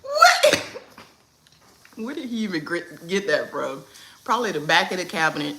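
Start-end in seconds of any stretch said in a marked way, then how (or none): 0.0-0.7 very high loud what; thrilled, shrieking surprise; delighted squeal. Real, not acted. Delighted reaction to son.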